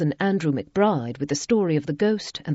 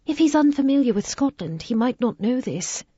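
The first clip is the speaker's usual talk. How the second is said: 1 lady's voice; 8 semitones higher than usual. A narrator's reading. Isabella voiced.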